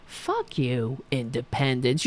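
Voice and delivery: low, quiet mocking repeat; sneering mockery. Real, not acted. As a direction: spit the word back with contempt. Mocking senator's word.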